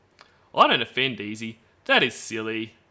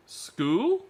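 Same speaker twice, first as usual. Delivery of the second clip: put-on girlish questioning voice; 9 semitones higher than usual. Reading girl's chat reply.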